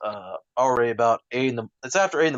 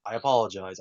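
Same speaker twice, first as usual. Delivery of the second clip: ordinary talk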